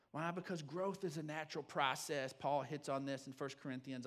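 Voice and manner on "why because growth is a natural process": ordinary talk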